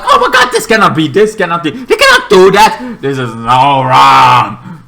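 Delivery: high, fast, laughing mock panic that slows into a sung last line; panicking scientists. Scientists panicking as monsters escape, in laughing mock panic. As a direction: ham up the scientists losing it, giggling through it. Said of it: "they're freaking out, like".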